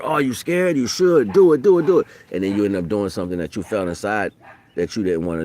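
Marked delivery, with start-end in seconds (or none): 0.0-2.1 higher, louder goading chant; taunting peers. Retold or imitated. Teens pressuring a scared kid, mock taunting. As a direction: egg them on like a peer. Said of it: "It's more pressure".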